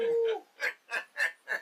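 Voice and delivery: high burst of real laughter. Real, not acted. Cohost laughs at the cane joke, real high laugh. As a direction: let a big laugh out up high.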